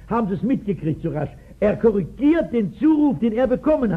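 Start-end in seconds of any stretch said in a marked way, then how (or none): none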